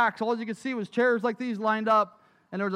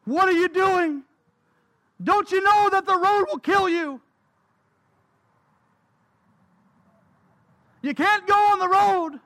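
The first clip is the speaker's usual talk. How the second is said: loud, higher, panicked scolding; frightened mother shouting; 5 semitones higher, 3 dB louder than usual. Acted. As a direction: yell at the toddler to save him. Mother scolding child near road.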